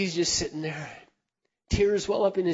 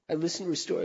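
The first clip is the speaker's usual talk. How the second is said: normal talking voice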